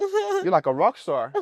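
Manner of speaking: chuckling through the compliment, slowed down; laughing. Real, not acted. Host teasing the guest about her jacket, laughing.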